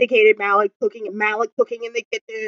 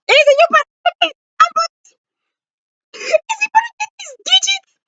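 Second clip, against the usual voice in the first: hype squeal. Pretend. Cheering Izzy on, voice shifted.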